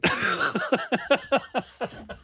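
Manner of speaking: high laughing fit; cackling after a food confession; high cackle. Real, not acted. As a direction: laugh at your own weird taste.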